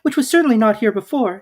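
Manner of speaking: put-on a surprised little girl; Alice's voice. A narrator's reading. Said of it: "said Alice".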